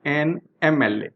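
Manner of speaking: each letter of M L A said apart, very slowly; slowly spelled out. Shown as a demonstration. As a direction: read it out letter by letter for the class. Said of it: "इस example को भी ध्यान से देखें".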